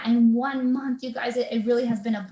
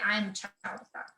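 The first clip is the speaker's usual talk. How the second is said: quiet affirmation; 3 semitones lower, 7 dB quieter than usual. Shown as a demonstration. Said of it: "And I would say".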